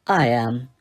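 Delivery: low, understated menace; low cool reveal. A narrator's reading. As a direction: drop it low as a cool revelation. Snape coolly reveals he is teacher.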